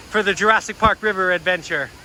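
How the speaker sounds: usual speaking voice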